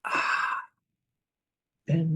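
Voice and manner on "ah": long voiceless sighing breath out; soft open-mouthed exhale; demonstrated exhale. Shown as a demonstration. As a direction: let the breath go in an audible "ah".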